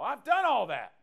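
high, loud boast; smug rich young ruler. Retold or imitated. Pastor voicing the ruler answering Jesus, high and boastful. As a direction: brag like he's already done it all.

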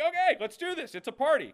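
high, hyped-up party cheer; excited crowd. Retold or imitated. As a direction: pump them up, mock eager. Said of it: "they're like".

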